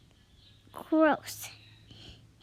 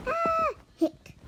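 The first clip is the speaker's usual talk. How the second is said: hiccup; 12 semitones higher than usual. Acted. Character has hiccups.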